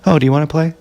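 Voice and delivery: lightly mocking, casual invitation; breezy retold taunt, mock-innocent; feigned friendly indifference. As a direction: offer it as if you're pals, to needle. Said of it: "being completely indifferent to you, but acting like we're friends".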